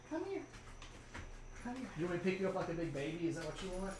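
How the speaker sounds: soft, high pet-talk voice; high, quiet cajoling; coaxing a dog. Real, not acted.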